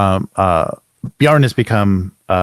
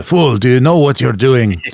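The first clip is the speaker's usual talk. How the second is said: lightly voiced indignant zealot, slightly raised; Zacharias; 4 semitones higher, 3 dB louder than usual. Game master retries Zacharias's voice, mildly theatrical rebuke. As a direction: try on a scolding villain voice, more contained. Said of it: "What's his voice?".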